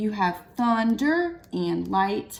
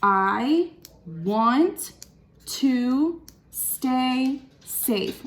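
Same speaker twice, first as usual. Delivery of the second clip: slow syllable count; 2 semitones higher, much slower than usual. Shown as a demonstration. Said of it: "triple checking my syllables".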